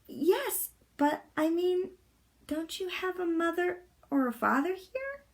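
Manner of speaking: put-on a puzzled little girl asking gently; Annika. A narrator's reading.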